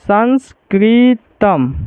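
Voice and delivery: संस्कृतम drawn out very slowly with a clear final म; slow teaching demo. Shown as a demonstration. Teacher to students, showing the hidden म, very slow. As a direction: spell संस्कृतम out syllable by syllable, slowly. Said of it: "इसको हम लिखेंगे".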